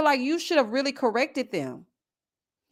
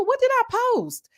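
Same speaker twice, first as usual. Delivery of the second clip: puzzled; 12 semitones higher than usual. Retold or imitated. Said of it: "I'm like".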